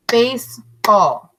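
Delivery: slow, split into two claps; clapped syllables. Shown as a demonstration. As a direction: clap out each syllable slowly. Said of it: "Let's clap it out".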